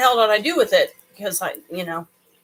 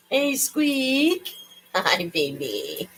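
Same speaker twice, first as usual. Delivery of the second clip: soft coo to a pet; 4 semitones higher, 6 dB quieter, much slower than usual. Real, not acted. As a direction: croon softly to the kitty. Greets the cat Squeak.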